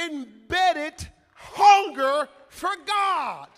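loud, slow, drawn-out proclamation; full-force preaching. Real, not acted. Preacher declaring worship rests on hunger for God, full-force preaching. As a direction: hammer each phrase, pausing between bursts.

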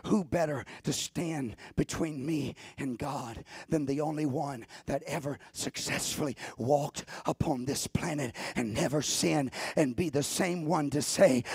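ordinary delivery

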